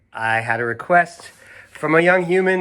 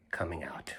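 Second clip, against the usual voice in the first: sudden whispered ending; 6 semitones lower, 16 dB quieter than usual. A narrator's reading. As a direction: drop to a whisper on the last words. Child vows never leaving room.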